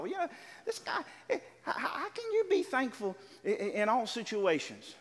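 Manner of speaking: high, quick, sputtering skeptic's voice that slows at the end; irritated churchgoer. Retold or imitated. Skeptical churchgoer grumbles about the ever-thankful pastor, high and sputtering. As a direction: play the baffled man in the pews muttering his doubt. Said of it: "He thought".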